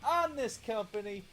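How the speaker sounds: a bad Brooklyn wrestling promoter hyping the match; terrible Brooklyn accent. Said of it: "That's my terrible Brooklyn accent".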